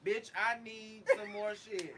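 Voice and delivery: slow, loud demanding customer voice; mock rude customer. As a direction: drag out a bossy order at the waiter. Imitating rude restaurant customer.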